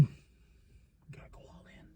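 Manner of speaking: whispered slow repeat of the pep talk. Retold or imitated. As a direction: lean in and whisper it. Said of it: "Jeff Jarrett's like".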